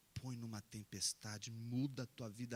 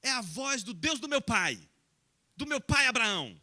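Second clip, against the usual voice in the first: proud declaration; 11 semitones higher, 16 dB louder than usual. Acted. Isaac proudly answers his friends.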